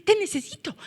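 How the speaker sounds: high-pitched needy pleading; mock pestering. Preacher acting out someone who won't let go, mock pestering. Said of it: "acosar a una persona".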